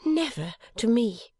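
high, slow wifely voice, proudly trusting; proud, high-voiced wife; Bella, proud. A narrator's reading. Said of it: "with a proud look".

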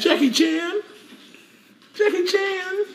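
squeaky pet-name call; cooing nickname. Real, not acted. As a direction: coo the dog's nickname up high.

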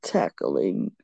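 low, drawn-out tackling; deep mock repeat. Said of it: "mocks cohost's slow word".

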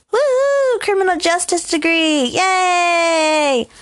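high-pitched celebratory whoop; mock cheer. Mock cheering for own degree.

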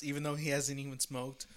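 ordinary talk, nothing marked

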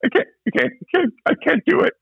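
high, pleading mock panic; imitating tearful panic. Nervous driver tells the host he cannot go on, mock tearful. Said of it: "And he's crying".

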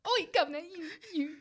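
high-pitched put-on Cockney call; mock British accent. Friend joining the accent bit, mock British. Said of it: "watch a bunch of British T V shows".

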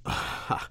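a short breathy chuckle of delight; nervous chuckle. Acted. Curator chuckles, pleased by the donation, breathy laugh. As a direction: chuckle at the offer of money.

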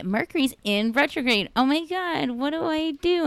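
high-pitched imitation of fretting people; mock panic. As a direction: play up the helpless worry, pitched high. Mimicking everyone panicking over retrograde.

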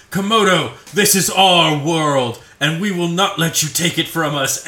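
loud, mock-heroic warrior declaration; heroic challenge. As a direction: declare it like warriors facing the villain. Warriors' speech to the villain, acted.

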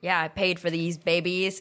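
normal talking voice

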